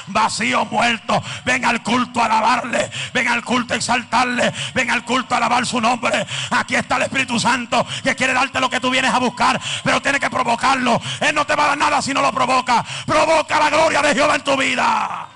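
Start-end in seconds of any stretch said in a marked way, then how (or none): 3.8-15.3 loud preacher's shout, quickening; preaching at full force. Acted. Preacher urging the listeners to provoke God's glory, loud. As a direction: push them hard to provoke glory.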